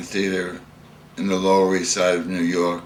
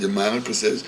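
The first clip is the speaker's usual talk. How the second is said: ordinary delivery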